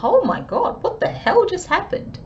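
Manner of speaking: high, laughing disbelief; playing stunned bystanders, with a laugh; shocked onlookers. People reacting to the blow-up, high laughing shock. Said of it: "people are gonna be like".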